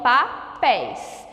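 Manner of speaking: "papéis" said slowly as pa... PÉIS; syllable by syllable. Shown as a demonstration. Teacher restoring the correct word, slow, split in syllables. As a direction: sound out "papéis" slowly, open stressed é. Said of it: "não é assim que a gente fala".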